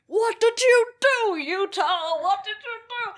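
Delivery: high, slow put-on cop voice; hammed-up cop accusing Utah; mock cop voice. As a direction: whine it like a scolding cop. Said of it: "they're like".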